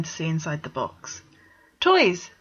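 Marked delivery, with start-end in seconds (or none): 1.8-2.3 high, loud blurted exclamation; exclaiming. A narrator's reading. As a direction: blurt it out to block him. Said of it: "Vegard exclaimed".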